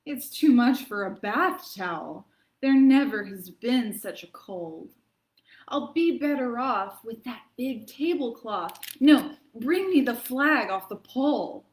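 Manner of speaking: hammed-up reading of a sniffly poem; milk every sneeze for the kids; dramatic poem reading. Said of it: "lends itself to a pretty dramatic reading".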